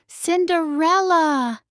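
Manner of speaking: high, slow, drawn-out call of the name; stepmother's shout. A narrator's reading. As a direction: yell up the stairs, drawing out the name. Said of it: "her stepmother shouted".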